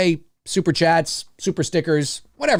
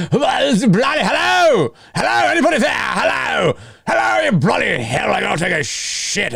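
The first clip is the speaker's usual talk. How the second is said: theatrical mock dub of Loomis, shouting high; calling into the dark; 5 semitones higher than usual. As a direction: bellow for Michael into the night. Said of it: "theatrical voiceover dubbing".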